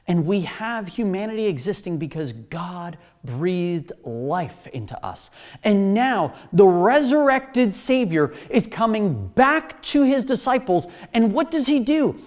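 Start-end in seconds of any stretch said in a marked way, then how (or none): 5.6-12.2 rising preaching. Real, not acted. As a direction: drive it home from the pulpit. Preacher building to climax.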